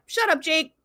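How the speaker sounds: imitating an outraged gang; mock indignant crew. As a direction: shout down the dissenter, half in jest. Acting out crew turning on Jake.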